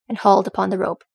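plain reading voice

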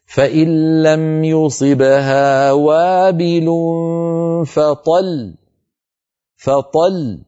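فطل with a slight push onto the doubled l before the stop; slow recitation, pressing into the double l of فطل; measured Quran recitation with stressed shadda on فطل; light press on the doubled lam. Shown as a demonstration. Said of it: "هكذا".

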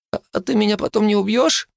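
high, hesitant, stammering question; frightened lad checks he will be spared; wary boy. A narrator's reading. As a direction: ask nervously, half hoping. Phil asks warily.

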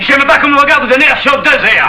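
high, indignant challenge; angry challenge. Acted. Marius objects to Panisse's look, angry challenge. Quarrel with older rival.

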